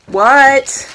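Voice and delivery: loud, high what; calling back. Real, not acted. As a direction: shout back to someone out of sight. Answering a call from elsewhere.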